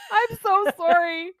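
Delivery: high, loud, drawn-out laughing apology. Real, not acted. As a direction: wail it slowly through laughter. Laughing apology to Danish listeners.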